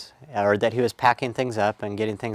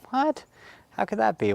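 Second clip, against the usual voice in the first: high-pitched mock bafflement; retold disbelief; 11 semitones higher than usual. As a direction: replay a puzzled kid's "what?", pitched up. Boy baffled by dentist summons.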